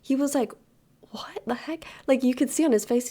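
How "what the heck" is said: soft, high stunned aside; hushed shock. Retold or imitated. Store employee reacts to seeing the shove, hushed mock shock. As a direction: gasp it under your breath, mock stunned.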